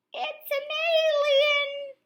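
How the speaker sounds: excited whisper. A narrator's reading. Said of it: "whispered excitedly".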